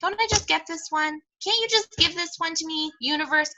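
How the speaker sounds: high-pitched pleading whine; mock self-pity. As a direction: plead with the universe, playing sorry for yourself. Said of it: "many pity parties, and I'll say".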